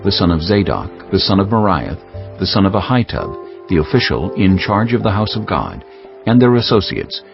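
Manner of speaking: usual speaking voice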